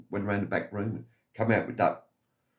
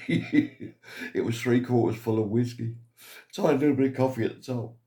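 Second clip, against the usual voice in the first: chuckling through the punchline; amused, quicker telling with a laugh; grinning as he gives the joke away; 3 semitones higher than usual. Real, not acted. Laughing through the whiskey story.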